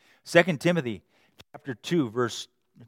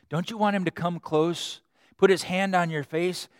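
ordinary talk

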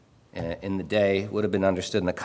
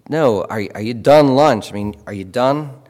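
insistent repeat; 2 semitones higher, 9 dB louder than usual. Retold or imitated.

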